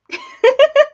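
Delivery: high, loud giggle; short burst of high laughter. Real, not acted. Owner amused by pet bird, high giggle.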